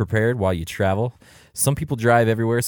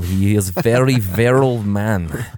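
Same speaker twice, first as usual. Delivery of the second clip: put-on Russian accent; 4 dB louder than usual. Russian accent as Putin fan.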